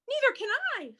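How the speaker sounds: high, wailing little bird voice; howl. Acted. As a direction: wail it high in mild panic. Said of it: "howled Flitter".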